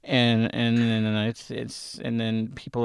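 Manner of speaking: confessing sadness about the Giants to his brother; mock sadness. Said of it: "I'm actually sad".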